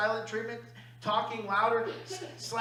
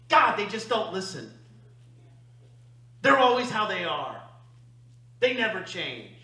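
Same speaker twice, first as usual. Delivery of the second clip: louder, fed-up complaint about others; exasperated blaming; 6 dB louder than usual. Retold or imitated. Complaining about people who never listen, exasperated and louder. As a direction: throw the blame outward, fed up.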